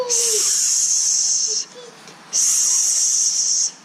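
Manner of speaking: long hissing sss; playful snake hiss. Shown as a demonstration. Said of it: "what does a snake do?".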